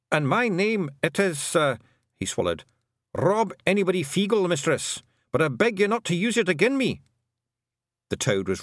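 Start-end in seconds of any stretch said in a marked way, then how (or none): 0.1-1.8 high Scottish clan chief, nervous; a worried wee chief addressing the hag; Rob Anybody voice. A narrator's reading. Rob Anybody nervously introducing himself to Tiffany, high Scottish. 3.1-7.0 high Scottish clan chief, nervous; a worried wee chief addressing the hag; Rob Anybody voice. A narrator's reading. Rob Anybody nervously introducing himself to Tiffany, high Scottish.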